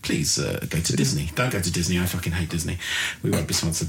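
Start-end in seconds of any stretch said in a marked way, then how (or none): 0.0-1.3 lower, mock sincere sponsor read. Host mocking podcasters reading sponsor ads, lower. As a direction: plead it like a cheesy ad, lower. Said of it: "podcasters read their own ads".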